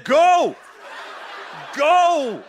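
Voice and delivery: commanding Go. Retold or imitated. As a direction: boom it out like a divine order. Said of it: "He said".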